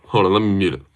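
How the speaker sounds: normal talking voice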